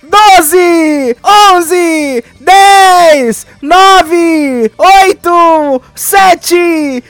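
high, loud shouted countdown numbers. Real, not acted. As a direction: shout every number like the last seconds of the year.

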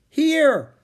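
high, loud answering shout; calling back. A narrator's reading. Annie answers Jack's search, calling back. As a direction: holler so you can be found.

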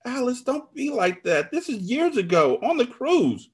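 high-pitched desperate appeal; pleading. A narrator's reading. Narrator excuses old affair to friend, pleading.

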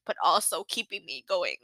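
ordinary talk, nothing marked

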